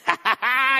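big, hearty, loud laughter; Jesus laughing with delight; jolly laugh. Retold or imitated. Jesus laughing as he keeps dishing out food, hearty.

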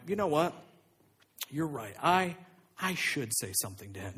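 low, soft, pausing as if pondering; calm concession. Acted. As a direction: give in quietly and thoughtfully. Jesus seems to agree, calmly.

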